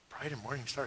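very soft, wavering puzzled repeat; puzzled echo. Acted. Stranger repeating the odd star sign back, softly baffled. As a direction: echo it under your voice, baffled. Acted listener repeats it in disbelief.

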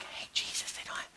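hushed, barely voiced whisper; casual whisper. Shown as a demonstration. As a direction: whisper it like a furtive aside. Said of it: "it's not like".

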